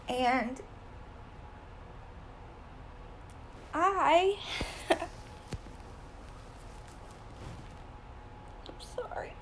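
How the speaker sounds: real tears catching the voice, a laugh then a whispered sorry; choked up mid-sentence, barely getting the apology out; voice breaking. Real, not acted. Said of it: "I'm kinda already getting emotional".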